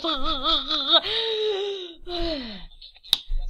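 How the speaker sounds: long, falling fake wail; mock sobbing. Overcome by how cute the dog is, exaggerated sobbing. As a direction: trail off in drawn-out mock sobs. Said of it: "cry at him and to, like".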